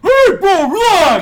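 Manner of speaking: shouting hey bro relax; mock yelling at a teammate; loud mock shout. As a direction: yell it like breaking up a fight. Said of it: "Gabe was".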